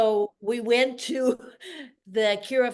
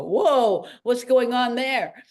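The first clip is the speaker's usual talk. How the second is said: louder, higher gawking question; amazed onlookers; 4 semitones higher, 5 dB louder, slower than usual. Retold or imitated. Onlookers at the studio railing, amazed and curious. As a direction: stare down from the railing, impressed. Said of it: "they would go".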